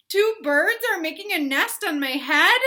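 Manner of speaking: hammed-up dismay; gasping alarm. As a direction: gasp then panic. Gerald alarmed.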